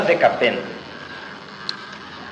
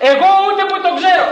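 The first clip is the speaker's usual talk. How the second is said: high, fast, rattled denial; Peter's panicked denial; 10 semitones higher, much faster than usual. Acted. Peter pressed by the servant girl, high rushed denial. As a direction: swear you never knew him, flustered. Peter denies, acted quarrel.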